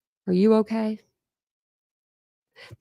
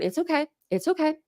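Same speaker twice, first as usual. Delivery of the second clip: calm mom imitation; 7 semitones higher than usual. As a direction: gently reassure a scared child, a touch higher. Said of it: "Very, like, calmly".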